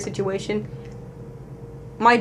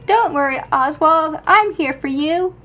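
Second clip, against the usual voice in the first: comforting coo; 6 semitones higher, 3 dB louder than usual. Acted. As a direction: coo to a frightened toy bear. Comforting the toy bear Oswald.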